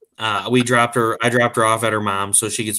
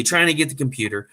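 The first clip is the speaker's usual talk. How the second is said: normal talking voice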